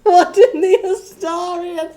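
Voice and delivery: high, loud disbelief; shocked. Real, not acted. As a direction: shriek it in disbelief. Shocked outburst.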